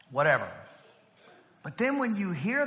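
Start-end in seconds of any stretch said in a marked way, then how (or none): 0.1-0.5 dismissive parent. Retold or imitated. Parent brushes off child's complaint.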